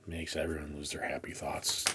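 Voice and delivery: soft mock-sad whisper. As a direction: breathe it like a secret. Mock-sad aside after bent comics.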